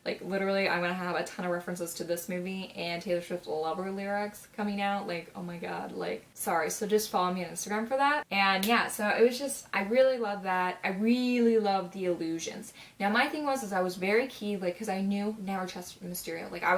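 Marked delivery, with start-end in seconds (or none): none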